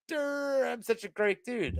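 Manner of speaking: high, drawn-out dopey boast; mocking imitation. Manager mocking the customer, high slow boast. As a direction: stretch it out to mock him. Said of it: "mocking him".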